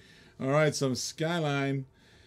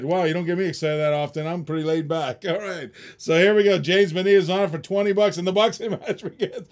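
hyped ringside commentary, higher and louder; excited play-by-play; 5 semitones higher, 9 dB louder than usual. Acted. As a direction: call the bidding like a boxing match. Mock boxing play-by-play of the bidding.

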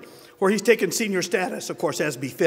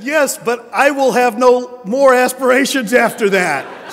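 slow, louder, higher mock Italian old man, laughing; Italian accent; 7 semitones higher, 9 dB louder, slower than usual. Guido replying to Michael about his age, in a slow hammed-up Italian accent. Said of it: "he has an Italian accent".

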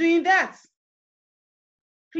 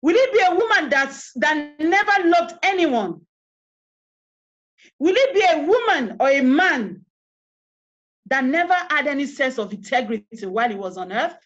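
forceful questions; 2 semitones higher, 6 dB louder than usual. Real, not acted. Rhetorical tombstone questions.

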